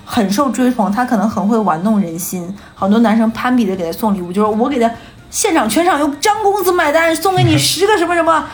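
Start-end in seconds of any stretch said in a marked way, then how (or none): 5.3-8.5 high, sustained hype-man announcement; club announcer. Retold or imitated. Club host announcing a rich patron's gifts, mock announcer. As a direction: proclaim it to the whole room, pitch raised.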